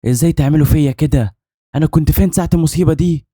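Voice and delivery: high-pitched, outraged outburst; screaming at her. A narrator's reading. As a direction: cry out in betrayed disbelief. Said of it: "صرخت فيها".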